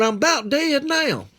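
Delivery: high, drawn-out wail; worn-out complaint. Retold or imitated. Boy telling the old man he is worn out, high complaint. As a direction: stretch it out in a high, pleading complaint.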